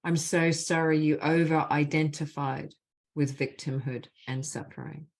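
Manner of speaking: measured, deliberate leading of a phrase; guided healing phrases. Acted. Facilitator gives the group apology phrases to repeat, measured and deliberate. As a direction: lead the group line by line, leaving space after each.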